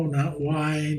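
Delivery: ordinary talk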